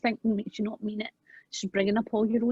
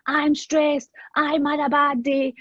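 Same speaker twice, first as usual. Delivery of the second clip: retold shout; 7 semitones higher, much slower than usual. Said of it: "But he would shout".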